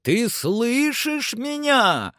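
high, slow, stretched call. A narrator's reading. Master checks that climber hears him, high calling voice. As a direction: make the question carry far upward.

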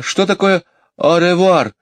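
put-on high, puzzled child; calling out a question. Jane asks the housekeeper a meaning, high puzzled call. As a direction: call it over, stumped by the foreign words. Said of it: "позвала она".

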